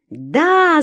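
high, urgent cry. A narrator's reading. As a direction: shout it out, voice leaping up. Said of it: "закричала я". (кричит, высоко)

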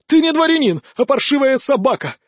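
high, heated outburst; angry insult. A narrator's reading. Swordsman insulting his elusive opponent, angry. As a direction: hurl the insult in outrage.

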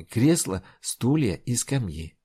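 plain reading voice

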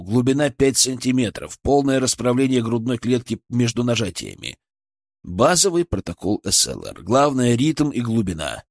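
ordinary delivery

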